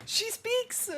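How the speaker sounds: amazed shout of surprise; gasping at a character finally talking; excited gasp. Real, not acted. Excited reaction to silent character.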